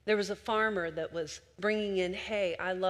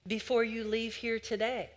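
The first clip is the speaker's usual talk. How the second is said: ordinary talk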